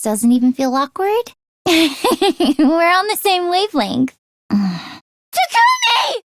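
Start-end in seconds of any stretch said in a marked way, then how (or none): 4.5-6.2 high, drawn-out shouted call. Acted. Calling out to a friend who is far away, a long high shout. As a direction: yell the name across a distance to get his attention. Calls out to Takumi.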